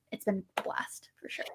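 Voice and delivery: whispered aside. Acted. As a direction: drop to a breathy whisper. Sudden aside after plain talk.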